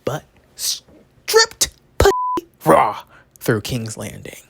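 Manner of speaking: slow, drawn-out loud cry; hammed-up anguished shout; melodramatic outcry. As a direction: wail it out with huge drama. Melodramatic Cersei walk-of-shame comparison.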